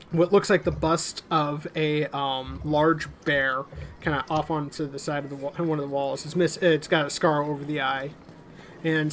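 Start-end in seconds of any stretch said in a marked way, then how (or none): none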